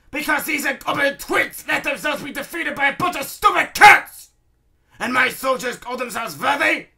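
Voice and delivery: high, loud villain's rant; bellowing. A narrator's reading. Baron raging to himself at his defeat, bellowing. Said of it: "He bellows to himself".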